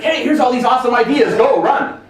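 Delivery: high, loud, overeager voice; hammed-up cheap hire pitching ideas; mock eager worker. Cheap hire pitching ideas to the boss, high and loud. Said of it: "is gonna be like".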